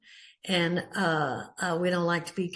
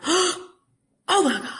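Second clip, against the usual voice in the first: sharp gasp, then a high, loud, drawn-out exclamation; mock panic, gasping and stretching it out; startled realisation; 9 semitones higher, 6 dB louder, much slower than usual. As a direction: act out suddenly remembering you are late. Acts out sudden realisation of lateness.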